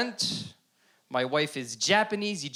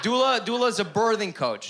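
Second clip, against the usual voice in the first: normal talking voice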